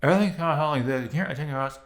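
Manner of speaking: mumbled through still lips, words running together; talking without moving lips. Shown as a demonstration. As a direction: keep your lips frozen while talking. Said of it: "Try to talk without moving your lips".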